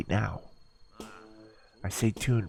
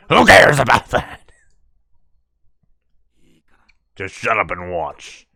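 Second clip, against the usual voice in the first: whisper yelling; 14 dB louder than usual. Acted. Irritated outburst mid-whisper scene.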